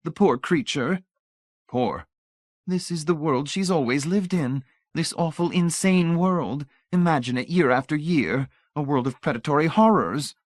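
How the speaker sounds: high, effusive lady's voice; pitying lady. A narrator's reading. Missus Pritchett laments Miss Rice's horrible world, pitying.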